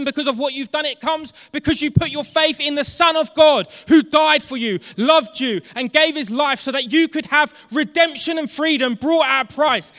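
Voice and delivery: rally them, get them excited; full-force preaching. Acted. Preacher urging the congregation to get excited, loud and shouting.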